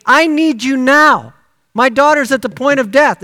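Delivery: desperate plea. Acted. Father begging Jesus for his daughter, loud desperate plea. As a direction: plead at his feet for your dying child.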